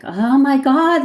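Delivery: put-on high, loud, slow drawn-out child's wail; mock toddler wail. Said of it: "the child starts screaming".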